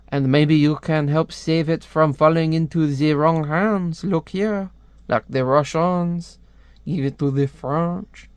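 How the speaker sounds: put-on French accent, drawn out at the end. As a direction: plead your case in a mock French accent. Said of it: "I hate doing a French accent".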